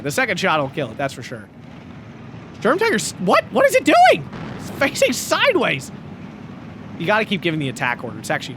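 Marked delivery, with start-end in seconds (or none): 2.6-5.9 high, disbelieving what is it doing; baffled surprise. Real, not acted. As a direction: sound baffled and amazed. Caster baffled by tank.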